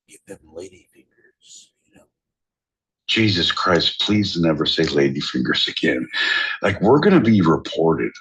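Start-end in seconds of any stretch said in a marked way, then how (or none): 0.1-2.0 breathy unvoiced whisper; library whisper. Real, not acted. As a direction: whisper it so nobody hears. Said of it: "We have to whisper".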